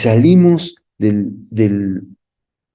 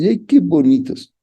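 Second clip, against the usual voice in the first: higher cooing over chubby cheeks; baby talk; 7 semitones higher than usual. Shown as a demonstration. Preacher pinching baby's cheeks, cooing baby talk. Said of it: "le tomas sus cachetes".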